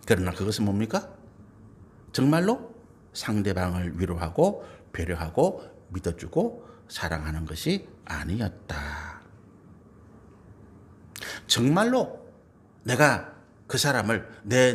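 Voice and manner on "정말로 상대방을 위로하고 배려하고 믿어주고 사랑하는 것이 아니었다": solemn low sincerity. Real, not acted. Preacher's solemn point.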